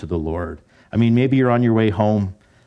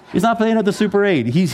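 laughing; 11 semitones higher than usual. Real, not acted. Preacher jokes about Jonah's hotel.

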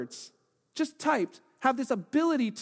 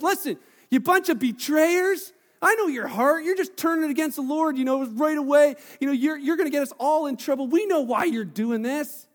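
mock-furious accusers, louder and higher; angry accusation; 6 semitones higher, 6 dB louder than usual. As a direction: hammer them with blame, voice up high. Accusers voiced angrily.

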